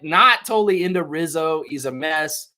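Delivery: ordinary delivery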